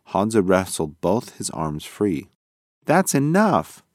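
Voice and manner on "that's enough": higher, louder sharp yell. Acted. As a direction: stop the tug-of-war. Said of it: "he yelled".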